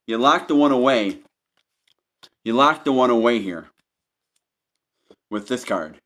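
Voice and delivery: normal talking voice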